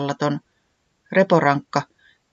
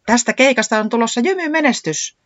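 high-pitched exultant exclamation; jubilant; 8 semitones higher, 4 dB louder than usual. A narrator's reading. Katiaa kansa sees the big crowd, rejoicing. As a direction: rejoice at a coming triumph. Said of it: "se riemuitsi".

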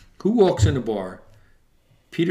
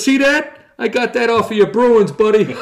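high, louder teasing jab; playful taunt; 8 semitones higher, 5 dB louder than usual. Retold or imitated. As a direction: rub it in playfully. Player teasing, choking him playfully.

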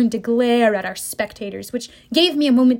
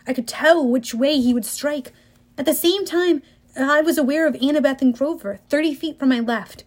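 ordinary delivery

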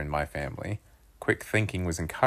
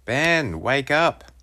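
loud, higher wake-up yell; 9 semitones higher, 5 dB louder, much slower than usual. A narrator's reading. Boy yelling to wake his sleeping brother, loud and high. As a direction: shout him awake. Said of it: "I yelled".

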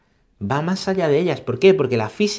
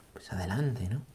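whispered encouragement; 4 semitones lower, 11 dB quieter than usual. Real, not acted. Priest urging the chosen one onward, whispered. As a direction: breathe it out as a soft aside.

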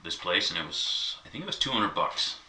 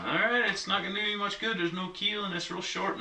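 lightly voiced seller, higher pitched; quoting the seller; 12 semitones higher than usual. Seller warns buyer about the boat, a lightly higher voice. Guy retells seller's warning.

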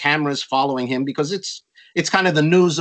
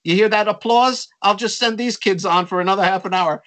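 cool festival boss; 7 semitones higher than usual. Retold or imitated. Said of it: "He says".

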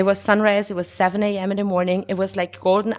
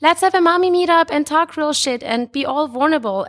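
mock upbeat invite; 8 semitones higher, 3 dB louder than usual. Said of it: "'Cause it would be like".

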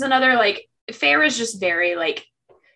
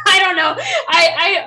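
laughing; 7 semitones higher, 4 dB louder, slower than usual. Real, not acted. Laughing outburst.

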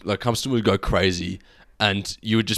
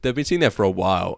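usual speaking voice

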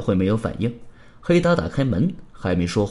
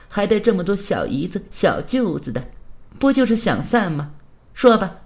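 higher-pitched scornful woman's voice; contemptuous; 6 semitones higher than usual. Acted. Rich woman dismissing her lover, contemptuous. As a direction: sneer it with haughty scorn. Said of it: "轻蔑地说道".

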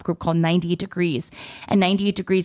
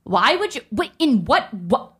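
high, sputtering disbelief; flabbergasted reaction; 5 semitones higher than usual. Retold or imitated. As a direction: stammer it out, too stunned to finish. Stunned reaction to the prank.

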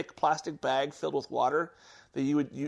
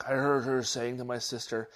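ordinary delivery

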